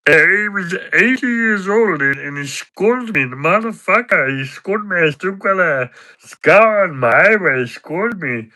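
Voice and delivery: high, swooping, aggrieved character voice; Patter. Acted. Character tells mate an old man scolded him, aggrieved impression. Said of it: "I can do an impression of Patter".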